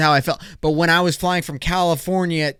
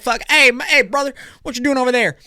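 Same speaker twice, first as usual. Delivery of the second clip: high, louder hammed-up Texas accent; mock Texan twang; 9 semitones higher, 3 dB louder, faster than usual. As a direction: greet a buddy like a Texas cowboy. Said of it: "talking like this".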